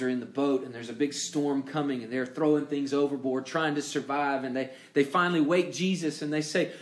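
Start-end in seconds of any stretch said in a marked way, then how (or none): none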